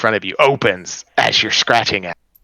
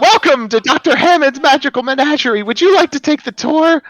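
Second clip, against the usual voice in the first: very high, loud and cracking, an eager stone guide; high cracking golem greeter; 6 dB louder than usual. Acted. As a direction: squeak it out high and loud like a crystal creature welcoming guests.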